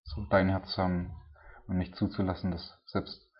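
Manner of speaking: earnest, mostly hushed prayer addressed to God; spoken prayer. Acted. Preacher leads the congregation in closing prayer, earnest and hushed.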